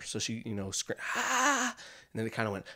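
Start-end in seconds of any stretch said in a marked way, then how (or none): 1.1-1.8 high "ah" at reduced volume; subsiding mock scream. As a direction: imitate a scream that is dying down. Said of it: "her scream started slowly coming back down".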